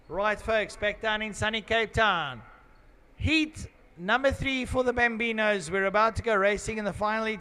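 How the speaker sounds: loud, high announcer voice, fast at the end; announcer welcome. Acted. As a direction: welcome the crowd like a race MC.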